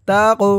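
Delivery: তাকু with a bouncing release on the q; echoing bounce closing তাকু; qalqalah push. Shown as a demonstration. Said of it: "ধাক্কা দিয়ে পড়তে হবে".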